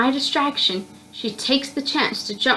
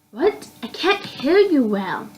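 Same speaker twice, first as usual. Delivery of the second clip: confused question; 4 semitones higher, slower than usual. Acted. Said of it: "I asked, still confused".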